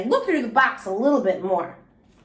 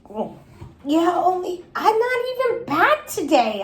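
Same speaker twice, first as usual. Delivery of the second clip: very nervous; 8 semitones higher than usual. Acted. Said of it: "very nervous".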